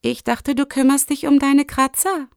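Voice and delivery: high, pointed question. A narrator's reading. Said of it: "fragte sie spitz". (spitz, mit hoher Stimme)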